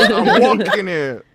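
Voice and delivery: loud, drawn-out East Coast American shout, half laughing; hammed-up American accent with a laugh; mock East Coast American accent. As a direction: ham up a Boston-style American accent, loud and slow. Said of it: "admit my Boston accent".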